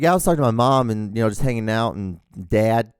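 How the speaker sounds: loud, twangy hillbilly "Yeah, I was talking to my mom"; hillbilly twang. Retold or imitated. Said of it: "I always sound like a hillbilly".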